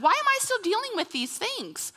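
high-pitched mock exasperation; acted frustrated plea; exasperated complaint. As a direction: wail the question in protest. Acted exasperated question to God.

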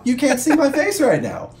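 squeaky, high and teasing. Acted. Teasing denial of bluffing.

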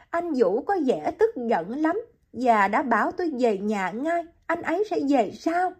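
put-on young woman. Hiền answers.

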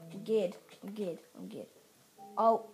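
ordinary delivery

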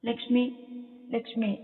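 high, faltering call of a name. Acted. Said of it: "ഇടറിയ ശബ്ദത്തിൽ ലക്ഷ്മി എന്നുള്ള വിളി". (ഇടറിയ ശബ്ദത്തിലുള്ള വിളി)

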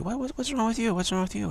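a friend jolted by your shaking and screaming; high, startled worry; alarmed friend. Acted.

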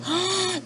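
high, quick delighted gasp; excited gasp. Acted. Reader gasps as lemur gets every donut, excited gasp. Gasp at panda's generous offer.

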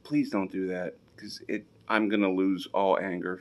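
soft, melting, can't stay mad; softened. Real, not acted. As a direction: go soft over the cute dog. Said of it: "I'm trying to be mad at you".

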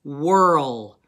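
whirl said slowly and loudly as a spelling word; dictation word. Shown as a demonstration. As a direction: give whirl clearly and loudly, as dictation for the class. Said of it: "here's a hint with this word".

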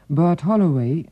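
ordinary talk, nothing marked

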